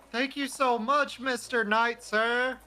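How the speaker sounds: put-on high, earnest child voice; grateful kid. Kid thanks the knight, high grateful voice. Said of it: "kid looks at you and he says".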